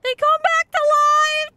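high panicked yell; terrified shriek. Real, not acted. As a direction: scream it in horror. Shocked realisation in game.

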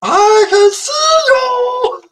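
high, very slow drawn-out sing; teasing singsong. Acted. Streamer singing to a caller he can see. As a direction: stretch it out high and playful.

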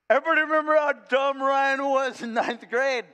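high, loud ribbing call; teasing shout. Retold or imitated. Old friends ribbing Ryan at a reunion, loud teasing. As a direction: call it out across the lunch table.

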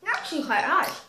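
talking while chewing candy; eating sour candy. Real, not acted. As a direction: mouth full of sour sweet. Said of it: "I'm literally biting".

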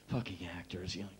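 the wardrobe woman muttering under her breath; bored muttered scorn. Retold or imitated. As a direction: mutter it, looking away.

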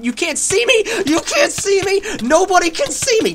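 very high, loud gloating; wild high-pitched gamer boast; gloating yell. Real, not acted. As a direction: shriek the taunt at blind enemies. Gloating while invisible in game.

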